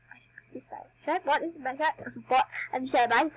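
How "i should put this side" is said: whispered aside to herself. Real, not acted. Vlogger talking to herself while shopping, whispered. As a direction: breathe it out, no voice.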